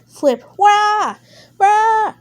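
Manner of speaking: high, loud yelps as he tumbles; surprised wah cries; startled wah. Acted. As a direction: cry out while flipping. Character flipped over.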